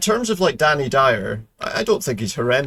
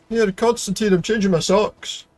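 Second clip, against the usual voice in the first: playful character voice; 8 semitones higher, slower than usual. Pretend. Talking to Constantine as his character.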